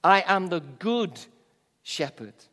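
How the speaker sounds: "good" punched loud and held, then a drop on shepherd; stress on good. Shown as a demonstration. Preacher shows where the saying's emphasis falls, stress on "good". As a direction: lean hard on "good", pause after it. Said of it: "the emphasis in that phrase".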